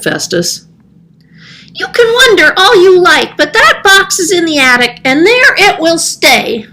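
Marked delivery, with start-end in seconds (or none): 1.7-6.7 a cat flatly refusing; stubborn cat. Acted. Cat refusing the old man.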